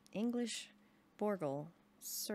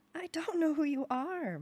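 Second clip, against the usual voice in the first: loud, high, slowed shout; 9 semitones higher, 7 dB louder than usual. A narrator's reading. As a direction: yell it at the stranger in a panic. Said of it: "my mother shouted".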